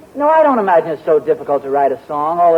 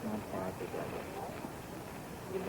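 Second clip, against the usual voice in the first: deflated mutter; 10 semitones lower, 25 dB quieter, much slower than usual. Acted. Husband repeats rejected guess, deflated.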